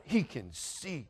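slow, soft, drawn-out words; stunned realization. Retold or imitated. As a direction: let the shock dawn slowly. Stunned realization, first take.

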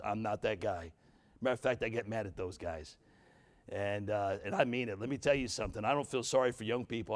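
ordinary talk

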